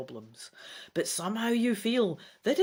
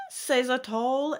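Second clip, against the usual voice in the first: usual speaking voice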